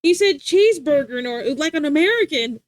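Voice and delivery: high, loud exaggerated gotcha; mocking onlookers. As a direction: imitate people teasing someone's pronunciation. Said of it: "They're like".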